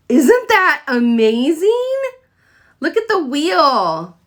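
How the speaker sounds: showing off the warmer, thrilled; excited reveal. Real, not acted. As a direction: sell the reveal with delight. Big reveal after countdown.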